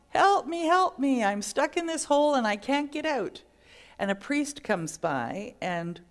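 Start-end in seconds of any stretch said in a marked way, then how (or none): 0.1-3.4 loud, high cry for help, getting desperate; yelling for help. Acted. Man stuck in a hole keeps yelling for help. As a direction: keep shouting up out of the hole. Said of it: "he keeps yelling".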